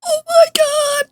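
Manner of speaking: very high, overwhelmed squeal; real fangirl squeal. Real, not acted. Streamer floored by her favorite character, high squeal. Overwhelmed fan reaction after cutscene.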